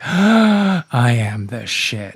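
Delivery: loud, drawn-out boast; mock self-adoration. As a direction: revel slowly and loudly in your own brilliance. Acting out delighted first listen.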